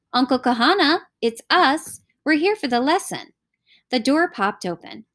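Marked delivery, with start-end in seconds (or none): 0.1-3.3 higher, mildly raised call. A narrator's reading. Jay telling his uncle they are here, calling out. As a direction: call lightly through a closed door. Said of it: "he called".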